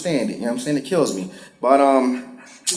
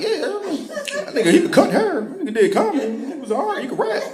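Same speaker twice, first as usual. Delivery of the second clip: mourner at the talk; 8 semitones higher, 3 dB quieter than usual. Retold or imitated.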